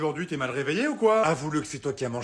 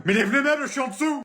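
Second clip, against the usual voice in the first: much higher, louder shout from under the furniture; desperate yell; 10 semitones higher, 4 dB louder than usual. Acted. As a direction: yell up at her to lift it. Trapped under furniture, yelling.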